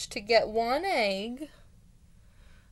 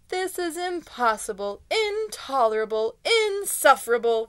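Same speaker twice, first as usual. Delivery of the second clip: exasperated bear, building louder word by word; outraged cry; 4 semitones higher, 6 dB louder than usual. A narrator's reading. Bear exclaims at the mouse in disbelief, mounting outrage. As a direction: cry it out in angry disbelief, punching each word harder. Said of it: "cried the bear, shaking with anger and disbelief".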